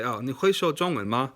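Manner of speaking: 你会说中文吗 in native Mandarin tones, ma light at the end; a clear Mandarin question ending on a light 吗. Shown as a demonstration. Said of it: "I use the word ma to make it a question".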